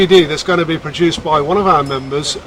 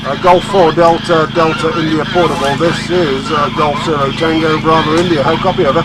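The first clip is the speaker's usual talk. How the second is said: radio call; 4 dB louder than usual. Real, not acted. Calling a station on the radio.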